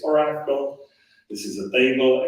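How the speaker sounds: normal talking voice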